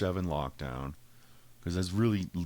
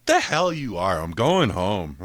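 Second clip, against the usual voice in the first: defiant retort; 4 semitones higher, 8 dB louder than usual. Retold or imitated. Defiant reply to arresting cops.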